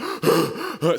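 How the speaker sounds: loud sobbing gasp; imitating a gasp for breath. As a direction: gulp air mid-sob. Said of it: "I'm like".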